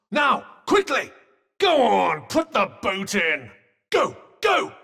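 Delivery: high, urgent battle cries; urgent egging on. Acted. Companion urges Frey to finish the fight, urgent shouts. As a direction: cheer them on with drawn-out, high-pitched urging.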